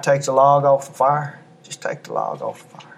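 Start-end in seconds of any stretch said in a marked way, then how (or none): 1.6-2.9 hushed whispered repeat. Shown as a demonstration. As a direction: whisper it softly to them. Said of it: "Can I encourage you tonight?".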